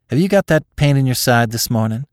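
mother checking on her daughter; lightly voiced country mother asking; concerned mother. A narrator's reading. Mother questions ailing daughter.

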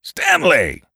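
high, loud radio-voice hail; booming shout. A narrator's reading. Radio voice calls down from a copter, booming shout. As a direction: shout his name as if from the sky. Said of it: "it shouted".

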